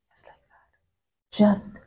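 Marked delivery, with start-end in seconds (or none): 0.1-0.7 whisper. Real, not acted. Whispered after death news.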